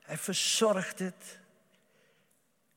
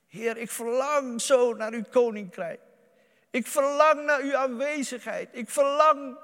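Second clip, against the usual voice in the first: louder, higher longing cry to the Lord; fervent prayer; 8 semitones higher, 8 dB louder than usual. Acted. Preacher prays aloud to the Lord, fervently pleading. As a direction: pour out your longing, pleading.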